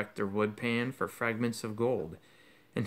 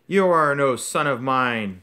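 loud, slowed bellow; 9 dB louder than usual. Acted. Father bellowing at his son in court. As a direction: disown him with a roar. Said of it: "bellowed Mister Crouch".

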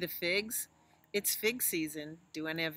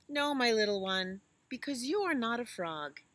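mother elephant voice; 6 semitones higher, 7 dB louder than usual. Acted. Mama Elephant answers her child.

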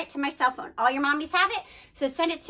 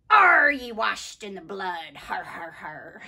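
pirate voice; 7 semitones lower, slower than usual. Pretend. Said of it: "pirate's favorite church song".

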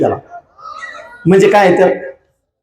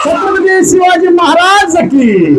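high, long-drawn crowd call; stretched-out, high-pitched rallying cry; rallying slogan call; 8 semitones higher, slower than usual. Real, not acted. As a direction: call out a chant for the crowd to answer. Leading crowd in loud slogans.

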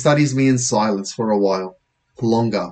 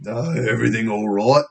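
British policeman. Acted. Policeman to startled boy, British officer voice. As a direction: put on a British bobby.

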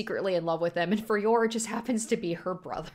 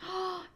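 sharp gasp; 10 semitones higher, 6 dB quieter than usual. Real, not acted. Bond's reaction on screen.

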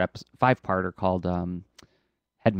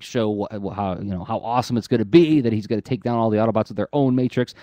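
normal talking voice

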